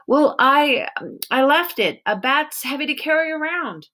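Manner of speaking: put-on young boy, flustered; nervous boy voice. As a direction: stammer out a weak excuse. Peter makes an excuse.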